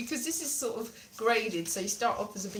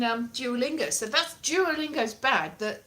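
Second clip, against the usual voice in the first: usual speaking voice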